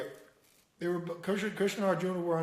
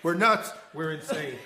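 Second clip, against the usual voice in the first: mock panic; 6 semitones higher, faster than usual. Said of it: "we're talking like this".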